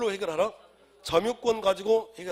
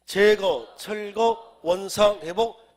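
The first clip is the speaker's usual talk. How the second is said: 크게 끊어 외치는 암기 구호; 학생들과 복창하듯 단어마다 힘주어; 구호처럼 선창; 8 dB louder, much slower than usual. Shown as a demonstration. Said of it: "시작". (큰 소리로 또박또박 복창)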